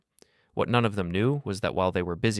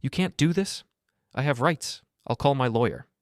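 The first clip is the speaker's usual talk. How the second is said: higher-pitched indignant protest; narrated anger, pitched well up; harder, angry; 6 semitones higher than usual. A narrator's reading. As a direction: protest angrily in a raised pitch. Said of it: "harder now, angry".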